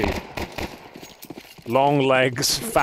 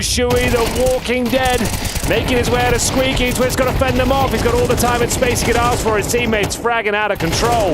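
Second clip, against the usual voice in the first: higher, louder hype casting call; excited play-by-play; 10 semitones higher, 3 dB louder than usual. Acted. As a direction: call the action like the round's on the line.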